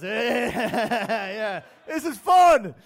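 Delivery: high, laughing, gleeful orders; loving every haircut; gleeful barber. Retold or imitated. Iraqi barber enjoying himself, high gleeful calls of next. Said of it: "he goes".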